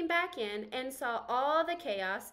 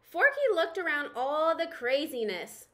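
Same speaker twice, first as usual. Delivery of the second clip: usual speaking voice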